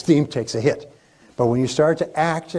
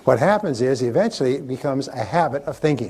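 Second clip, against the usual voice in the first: normal talking voice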